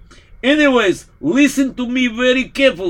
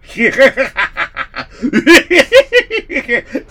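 mad laughter; 4 dB louder than usual. Acted. Priest cracking up, insane.

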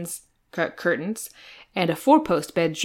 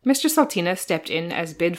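usual speaking voice

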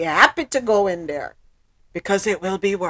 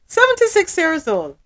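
Seventy-six pitched higher in shocked disbelief; 8 semitones higher, 3 dB louder than usual. Real, not acted. Repeating the man's age in shocked disbelief. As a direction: say Seventy-six like you can't believe it.

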